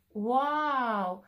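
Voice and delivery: awed wow; a gasp of wonder at the books; amazed. Acted. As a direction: rabbit amazed at the book pile. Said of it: "spuse uimit".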